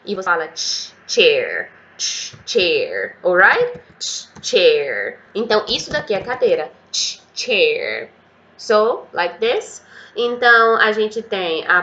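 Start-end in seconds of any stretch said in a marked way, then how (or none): 1.1-5.2 "chair" começando com tch forte, como caminhão freando. Shown as a demonstration. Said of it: "Pensa no caminhão freando que faz tch". 6.9-8.1 "chair" começando com tch forte, como caminhão freando. Shown as a demonstration. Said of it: "Pensa no caminhão freando que faz tch".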